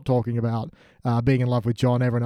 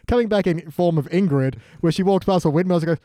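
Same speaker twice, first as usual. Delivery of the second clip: ordinary delivery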